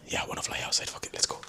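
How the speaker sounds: eager whisper. Real, not acted. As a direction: whisper it under the breath, itching to go out. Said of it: "sorry for swearing".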